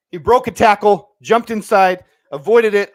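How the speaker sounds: loud, thrilled play recap; buzzing over the run; excited recap. Real, not acted.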